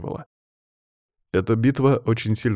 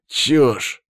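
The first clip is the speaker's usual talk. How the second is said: strained, louder angry outburst; higher, strangled denial; forced out; 5 semitones higher, 5 dB louder than usual. A narrator's reading. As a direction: squeeze it out indignantly. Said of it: "выдавил Павел".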